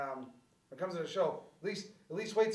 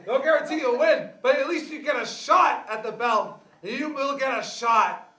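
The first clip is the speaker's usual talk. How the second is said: wrestling promo; 5 semitones higher, 10 dB louder than usual. Acted. Hyping the title belt.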